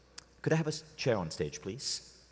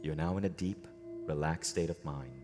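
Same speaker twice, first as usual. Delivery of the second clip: guided meditation. Acted. Leading a room into a relaxed state, guided meditation. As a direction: lead them inward, unhurried and measured.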